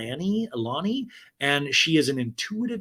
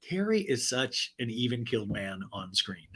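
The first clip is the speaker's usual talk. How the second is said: usual speaking voice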